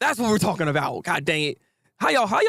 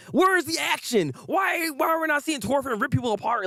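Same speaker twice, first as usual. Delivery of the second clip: higher, shouted mock complaint; mock impatient fan; 8 semitones higher than usual. Vlogger mocks impatient viewers, shouting high for action. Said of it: "as opposed to".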